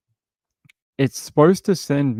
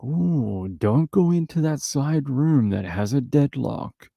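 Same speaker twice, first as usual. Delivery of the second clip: mock spooky warning; 4 semitones higher, slower than usual. Mocking the dead owner's secrecy.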